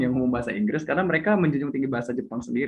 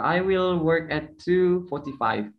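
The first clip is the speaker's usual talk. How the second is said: slow, careful British English demo; British time phrasing demo; 3 semitones higher, much slower than usual. Shown as a demonstration. Host demonstrates British way of telling time slowly. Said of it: "misalnya jam-jam dua empat puluh lima".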